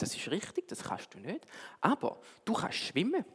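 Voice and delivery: put-on softly whispered wise old owl. Wise owl quietly comforts the little fish, whispered. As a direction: hush it like a gentle old owl.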